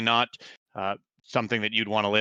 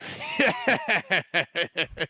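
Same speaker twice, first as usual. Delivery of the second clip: guard's laugh; 11 semitones higher than usual. Acted. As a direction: let out a big laugh.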